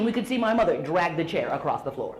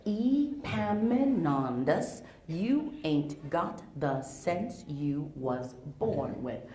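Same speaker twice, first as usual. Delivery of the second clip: slow, softer mama scolding; mama's line repeated more quietly for kids; scolding mama, quieter; 5 semitones lower, 6 dB quieter, much slower than usual. Retold or imitated. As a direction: drag out the scold, low and deliberate. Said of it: "What she says is".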